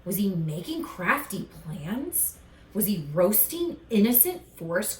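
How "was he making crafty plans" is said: plain reading voice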